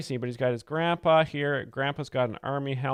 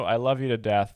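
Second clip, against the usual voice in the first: ordinary talk, nothing marked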